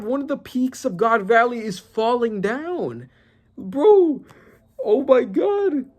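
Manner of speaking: real awe as the mountain falls; shocked disbelief. Real, not acted. Reacting to the manga panel, stunned high exclamations. Mountain peak collapsing on the page.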